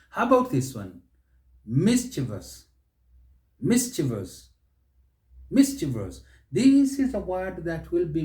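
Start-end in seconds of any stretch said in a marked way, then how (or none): 1.6-6.2 "Mischievous" in three syllables, MIS-chi-vus; correct mischievous. Shown as a demonstration. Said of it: "is simply mischievous".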